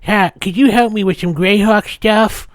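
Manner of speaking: put-on high voice of a newcomer; eager newcomer. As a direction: play a hopeful new player. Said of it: "like, a new person said".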